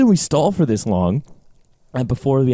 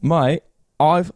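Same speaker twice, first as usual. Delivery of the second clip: Cockney by mistake; 5 semitones higher than usual. Pretend. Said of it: "I keep doing Cockney".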